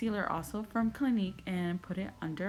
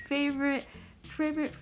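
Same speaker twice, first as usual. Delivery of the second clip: excited; 8 semitones higher than usual. Real, not acted. Gushing over her favorite lipstick.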